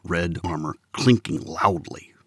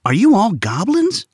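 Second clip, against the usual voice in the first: prodding; 11 semitones higher, 4 dB louder than usual. Acted. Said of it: "Alvin prodded".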